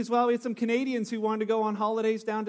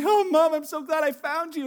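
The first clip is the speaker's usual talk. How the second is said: put-on relieved child; 5 semitones higher, 4 dB louder than usual. Child hugs the wrong leg.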